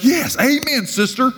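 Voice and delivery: high, sing-song mock amen cheering; hammed-up congregational enthusiasm; mock approval. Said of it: "She just assumed that I would be like".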